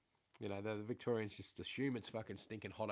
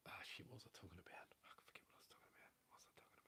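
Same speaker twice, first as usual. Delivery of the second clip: fast whisper, barely voiced; whispered to himself; 3 semitones lower, 21 dB quieter, faster than usual. Real, not acted. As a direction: mutter to yourself, lost the thread. Loses his train of thought.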